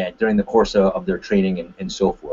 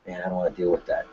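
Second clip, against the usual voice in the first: low, soft, defeated grumble; acted meek brush-off; dejected avoidance; 5 semitones lower, 9 dB quieter, faster than usual. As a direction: back down quietly, not wanting trouble. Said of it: "Is that a good response".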